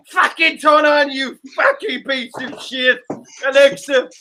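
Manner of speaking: slow, loud, high mock-British rant; exaggerated British accent, shouting at a gadget; bad British accent. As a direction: drag out the swearing in a hammed-up British accent. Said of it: "is that the way you sound?".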